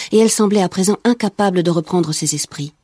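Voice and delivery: plain reading voice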